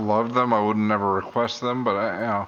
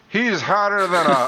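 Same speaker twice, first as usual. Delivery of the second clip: put-on country accent; 9 semitones higher, 5 dB louder than usual. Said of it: "I'm gonna start".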